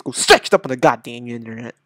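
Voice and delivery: usual speaking voice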